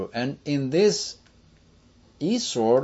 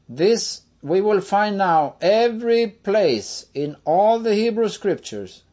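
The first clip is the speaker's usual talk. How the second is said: ordinary delivery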